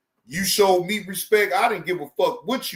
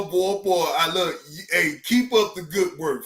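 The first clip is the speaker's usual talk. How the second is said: ordinary talk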